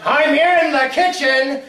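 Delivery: high, loud, drawn-out shout; slow, forceful answering holler; calling out. Acted. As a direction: yell back with mounting impatience. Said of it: "I call out".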